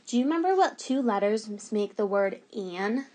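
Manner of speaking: ordinary talk, nothing marked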